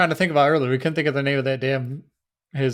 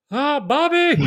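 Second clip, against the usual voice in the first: laughing Texan dad voice; high, laughing Hank Hill voice; Hank Hill impression; 13 semitones higher, 4 dB louder, slower than usual. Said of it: "that Hank Hill all the time".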